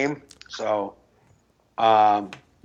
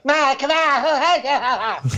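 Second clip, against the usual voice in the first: slow, high, dragged-out mock of the ex; 11 semitones higher, much slower than usual. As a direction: stretch it like an annoying ex. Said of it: "everything that came out of her mouth was like".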